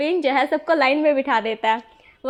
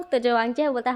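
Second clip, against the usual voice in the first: ordinary delivery, nothing marked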